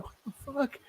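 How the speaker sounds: high, hushed mock disbelief; squeezed, quiet retold freak-out; retold meltdown. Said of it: "I melt down on the guy".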